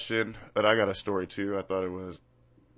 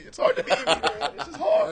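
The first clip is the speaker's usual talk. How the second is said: laughing through the words, much higher; giggling at his own joke; 7 dB louder than usual. Real, not acted. Joking boast, laughing.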